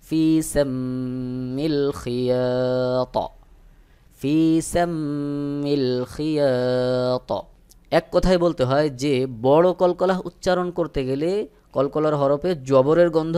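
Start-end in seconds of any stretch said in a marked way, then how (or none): 0.1-7.3 ফি সাম্মিল খিয়াত recited very slowly, the final ত stopped with a zabar-like bounce; Quranic recitation demo. Shown as a demonstration. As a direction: chant খিয়াত slowly, echoing the ত open-mouthed. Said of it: "যেমন". (খিয়াত ধীরে, ত-তে হালকা জবরের মতো কলকলা)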